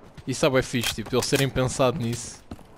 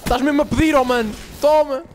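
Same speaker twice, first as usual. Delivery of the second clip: excited taunt; 11 semitones higher, 4 dB louder than usual. Real, not acted. Taunting an enemy while killing.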